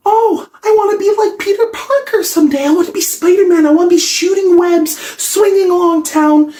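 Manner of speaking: put-on squeaky, very high excited child voice; hammed-up high-pitched kid daydreaming; little kid. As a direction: gush like a starry-eyed youngster. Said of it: "you know how little kids".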